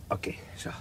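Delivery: voiceless murmur; barely audible to himself; hushed note in passing; whispered aside. Acted. Quiet aside while timing.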